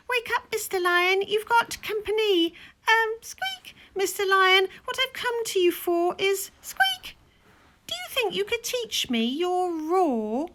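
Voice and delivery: high, squeaky and nervous; timid high mouse voice. Acted. The little mouse nervously wakes the lion, high squeaky voice. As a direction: squeak it out, scared but polite.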